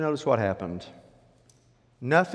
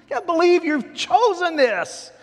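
high, loud, slow lament; anguished grief; 7 dB louder than usual. Acted. Good spies grieving the people's choice, anguished. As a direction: cry it out in acted dismay. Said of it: "which was a sign of grief".

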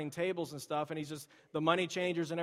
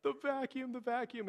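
mock wail, higher; imitating crying; 8 semitones higher than usual. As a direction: echo a sobbing toddler, lightly. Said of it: "he was just crying and weeping about it".

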